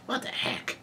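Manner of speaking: hushed, voiceless disbelief; very soft whispered reaction; stunned whisper. Real, not acted. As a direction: whisper it under your breath in real bafflement.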